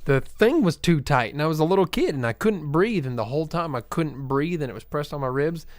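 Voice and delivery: usual speaking voice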